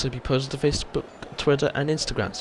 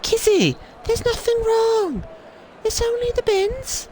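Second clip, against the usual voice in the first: very high, slow sing-song pet talk; doggy voice; 4 dB louder, slower than usual. Retold or imitated. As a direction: coo at the dog to calm her. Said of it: "in the doggy voice".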